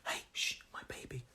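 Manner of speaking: whispered shushing; hushing. Acted. Parent of a young baby shushing people, hushed whisper. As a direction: hush them in an unvoiced whisper. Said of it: "I have to say, "Shh".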